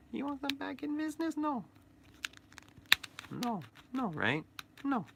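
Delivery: very high squeaky threat; high squeaky doll warning; creepy toy voice. Acted. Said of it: "That's how he talks".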